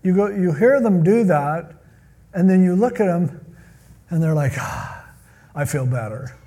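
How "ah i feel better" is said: low, soft sigh of relief; imitated relieved exhale, pitched down; mock relief. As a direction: let it out low, like a weight lifted. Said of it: "here's what happens".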